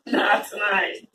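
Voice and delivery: ordinary delivery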